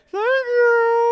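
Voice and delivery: very high, loud fake child voice; hammed-up falsetto kiddie thanks; pretending to be the kid. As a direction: squeak it out as a mom faking her kid. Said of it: "pretended to be the kid".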